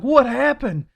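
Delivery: high, loud mock-alarmed question; questioning coworkers. Coworkers seeing the bloody forehead, loud questioning. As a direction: imitate coworkers demanding an answer. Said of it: "everybody's looking at me like".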